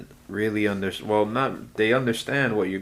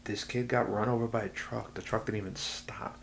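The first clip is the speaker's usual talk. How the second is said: grim whisper; 7 dB quieter than usual. Real, not acted. Grim detail of child's accident.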